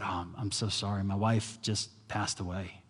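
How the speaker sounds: soft, subdued apology; grieving apology. Retold or imitated. A grieving father softly apologizing to the man. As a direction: quietly say sorry as a widower.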